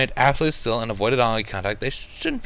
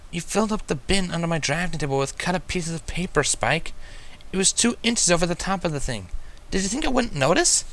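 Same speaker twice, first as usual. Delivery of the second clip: higher, exasperated unicorn scolding; blunt scolding; 8 semitones higher than usual. A narrator's reading. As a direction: scold him bluntly, fed up. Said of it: "she questioned bluntly".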